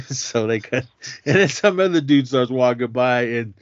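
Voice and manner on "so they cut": ordinary talk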